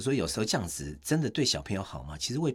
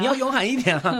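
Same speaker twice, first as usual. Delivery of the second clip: high, loud mock parent urging, with a laugh; scolding parent; 6 dB louder than usual. Parent urging a child to be brave, mock scolding. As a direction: imitate a parent's exhortation, pitched way up. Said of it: "爸妈就会说".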